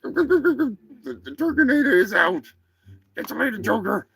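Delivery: high-pitched turkey villain sign-off; cocky Turkinator turkey. Acted. Turkey bids the humans farewell, high and cocky. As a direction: swagger out in a high cartoon voice.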